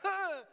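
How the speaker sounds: sudden high yelp of fright; loud mock scream of terror; startled scream. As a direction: shriek as if an angel just appeared. Person startled by an angel appearing.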